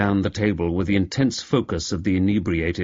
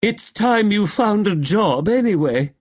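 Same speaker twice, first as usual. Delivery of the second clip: put-on slurred drunk; 11 semitones higher than usual. Drunk jab.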